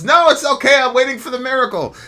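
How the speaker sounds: high, loud breezy refusal; stubborn refusal. Retold or imitated. Stranded man turning down the log, high and stubborn. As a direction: wave off the rescue in a high voice.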